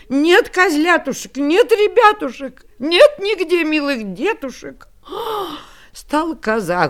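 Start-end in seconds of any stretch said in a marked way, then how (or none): 0.1-4.9 grieving mother goat. Acted. Mother finds kids gone. 5.1-5.6 horrified gasp. Acted. Mother goat horrified.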